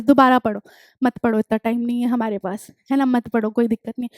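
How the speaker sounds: low, soft offhand aside; quiet aside. Real, not acted. Teacher waives the rereading for her students, quiet aside. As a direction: drop the voice for a casual concession.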